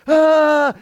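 loud, high, sustained wail; mock baby scream. Grown man imitating a baby wanting a drink, mock scream. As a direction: wail like a demanding infant, hammed up. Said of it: "I cry and scream until it appears".

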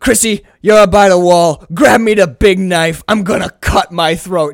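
loud, emphatic, hammed-up command; mock screaming rage. Imitating Nicolas Cage calling to Chrissy, mock rage. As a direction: order her about in theatrical fury. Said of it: "He's screaming his rage".

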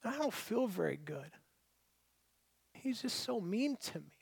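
soft, wounded retold voice; tearful. Upset drive-through worker confiding in a customer, tearful. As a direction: imitate a hurt woman close to tears, quietly. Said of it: "this poor woman is tears in her eyes".

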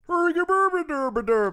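high, loud gibberish chef babble; Swedish chef voice. Acted. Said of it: "the Swedish chef".

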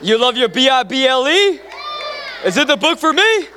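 high, loud question lifting at the end; playful rallying call. Acted. Preacher quizzing the congregation on loving the Bible, playful rallying call.

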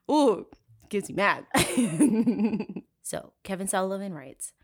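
ordinary talk

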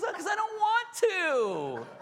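put-on high, slow teen whine through a laugh; bratty daughter. Daughter telling parent why she won't clean, whining. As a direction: drag it out like a sassy teen. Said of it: "She goes".

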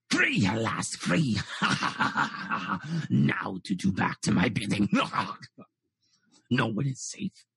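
low, otherworldly monster voice; eldritch horror voice. Acted. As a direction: speak as an ancient freed horror, low and gloating. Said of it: "You can hear this eldritchy horror of a".

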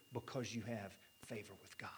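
hushed whisper. Real, not acted. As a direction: drop to a breathy whisper of comfort. Whispered reassurance in sermon.

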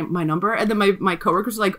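usual speaking voice

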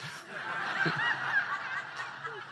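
squealing high laugh at own joke. Real, not acted. Preacher laughing at his own joke, high.

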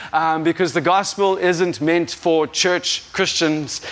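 ordinary delivery

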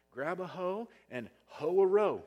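loud, high call to get to work; fired-up preacher shout; shouted exhortation. Real, not acted. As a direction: bellow it like a rally cry.

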